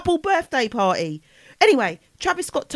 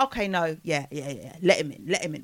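low, soft, offhand mumble; soft mock-indifferent imitation; Kylie; 7 semitones lower, 7 dB quieter than usual. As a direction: drop it low and quiet, barely bothered. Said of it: "Kylie actually speaks like that".

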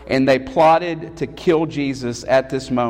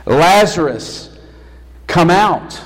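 loud, drawn-out call with falling ends; loud commanding cry; 2 semitones higher, 6 dB louder, slower than usual. Acted. Preacher voices Jesus calling Lazarus from the grave, loud command. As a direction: call it out with weight, word by word. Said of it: "but in a loud voice".

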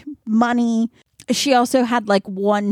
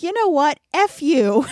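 defiant; 5 semitones higher than usual. Retold or imitated. Andy quitting on Miranda.

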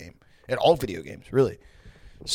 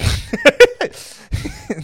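loud burst of laughter; 8 dB louder than usual. Real, not acted. Big laugh at joke.

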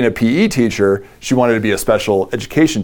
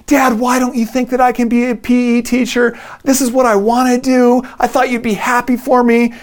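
put-on much higher, tearful teen daughter pleading; crying daughter; 12 semitones higher than usual. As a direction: imitate a sobbing teenage girl begging her dad. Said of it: "buckets of tears start flowing out".

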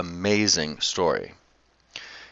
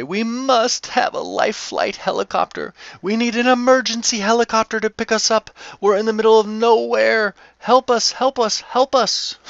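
pitched way up, hammed-up frantic begging; mock panicked pleading; 11 semitones higher than usual. Stranded tourists phoning a friend for a helicopter, mock panicked high plea. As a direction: whine it high like a helpless tourist. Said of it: "screaming and yelling".

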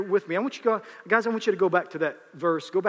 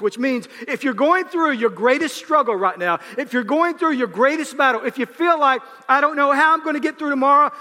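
loud, high, driving preacher's call; full force preaching; 8 semitones higher, 9 dB louder than usual. Acted. Preacher rallying the congregation, loud fervent preaching. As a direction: preach it out, rising to a shout.

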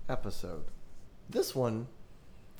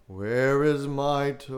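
slow, louder moaning call; a ghost calling out as it draws near; groaning ghost, closer; 3 semitones higher, 5 dB louder, slower than usual. Acted. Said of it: "it groaned".

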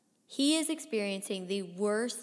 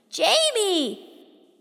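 loud, high, long-held shout; long shouted call; 11 semitones higher, 12 dB louder than usual. A narrator's reading. Boy getting no answer from Jamie, long desperate shout. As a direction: holler the name, stretching it out.

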